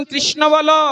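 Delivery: urging call. Acted. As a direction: call out insistently to the child. Prabhu urges the boy loudly. (জোর গলায় উঁচু সুরে ডাক)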